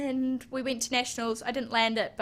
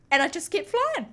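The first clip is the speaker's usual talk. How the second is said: excited building; 10 semitones higher, 4 dB louder than usual. Retold or imitated. Retelling the endless jump.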